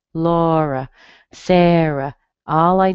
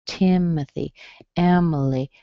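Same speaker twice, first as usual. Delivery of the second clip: usual speaking voice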